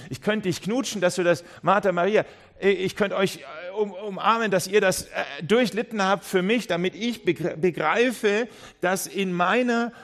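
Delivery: overflowing grateful outburst to Lazarus; effusive thanks. Pretend. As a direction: pour out thanks as if ready to hug them. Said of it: "Du kannst dem Lazarus Danke sagen und sagen".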